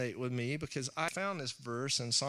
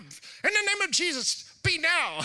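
high, loud mock deliverance command; hammed-up rebuking preacher voice; mock rebuke; 13 semitones higher, 5 dB louder than usual. A pastor mimicking himself rebuking in Jesus' name.

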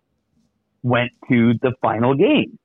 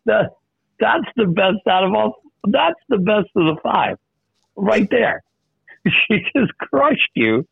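laughing delight; 10 semitones higher than usual. Real, not acted. Host cracking up at story.

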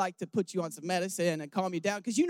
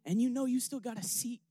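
softer, choked-up words through tears; crying; 4 dB quieter than usual. Real, not acted. Preacher tells the congregation about going home, crying softly. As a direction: let the tears break through, quiet. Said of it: "I told you I'm gonna cry".

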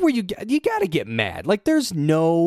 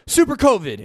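pitched-up, louder mock announcement; mock alarm; 10 semitones higher, 4 dB louder than usual. Mocking people who hype a new covid strain, hammed-up alarm. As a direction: sell it like a scary new headline. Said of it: "And then they're like".

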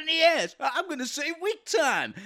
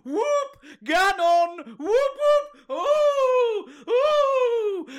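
whooping; 9 semitones higher, much slower than usual. Pretend. Hype man whooping.

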